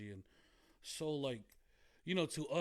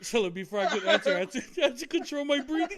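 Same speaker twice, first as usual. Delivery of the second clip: laughing; 9 semitones higher, 11 dB louder than usual. Real, not acted. Cracking up telling the story.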